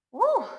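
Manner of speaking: high excited whoop. Real, not acted. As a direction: let out a cheer. Cheering during hard exercise.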